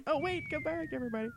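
high pleading call after the listeners; mock plea to listeners. Cohost mocks listeners leaving, high pleading call. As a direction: beg the listeners to come back, hammed up.

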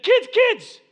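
high, loud shout over screaming; acted father hollering reassurance; dad calling out. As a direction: shout to settle panicking kids.

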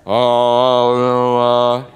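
long, loud held vowel sound; loud wordless booming. Acted. Preacher imitating the voice the companions heard, loud drawn-out noise. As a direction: blare it like an indistinct voice.

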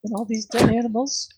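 high-pitched put-on female character; high character voice, a touch romantic; Maya. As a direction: lift the voice high for a dreamy girl. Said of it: "uh, she's like".

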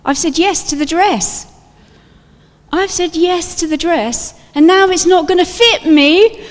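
high, loud sudden realisation; comic panic. Pretend. Preacher imagining Mary worrying about her wedding dress. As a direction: squeal it in mock dismay. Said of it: "I'd be thinking".

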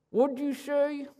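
high, small pursed-lip voice; narrow-mouthed frog. Acted. Frog hiding its wide mouth from the snake, high pursed voice. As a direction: squeeze it through a tiny puckered mouth.

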